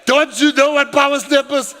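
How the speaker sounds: loud, high mimicked disbelief; exasperated child. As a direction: scold dad loudly, in imitation of his kids. Sons' exasperated reply, retold.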